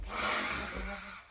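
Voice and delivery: breathy playful roar; whispered tiger roar. Retold or imitated. Trainer playing tiger for the kid, breathy roar. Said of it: "and I was".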